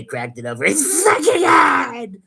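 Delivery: mock fury erupting into a high, loud shout; exaggerated angry retelling. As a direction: blow up on "fucking head" as an over-the-top angry impression. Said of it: "This is how angry he got".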